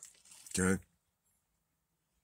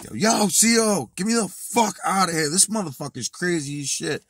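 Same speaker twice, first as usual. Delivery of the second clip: panicked shout; 12 semitones higher, 9 dB louder than usual. Retold or imitated. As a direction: yell for the CO like you need out now. Yelling for guard to escape.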